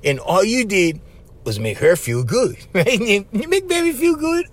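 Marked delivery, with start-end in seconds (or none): 3.0-4.5 high cooing voice, sweet and coaxing; cooing baby talk. Pretend. Telling listeners how to charm her, cooing high. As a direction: coo it like to a baby.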